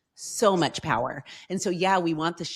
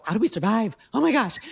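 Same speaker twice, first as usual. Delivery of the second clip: higher, louder playful mock alarm; mock panic; 6 semitones higher, 5 dB louder than usual. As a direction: ham up the money worry. Mock panic quoting younger selves.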